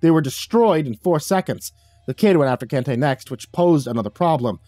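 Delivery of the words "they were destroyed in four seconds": ordinary delivery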